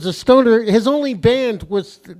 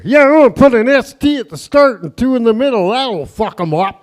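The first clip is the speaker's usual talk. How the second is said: mock scheming inventor; 2 semitones higher, 5 dB louder than usual. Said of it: "the fucking asshole invented the word".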